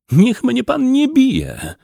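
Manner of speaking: high, drawn-out plea; frightened pleading. A narrator's reading. Tearful poor man begs the farmer not to hit him, pleading. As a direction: beg for mercy, high and slow.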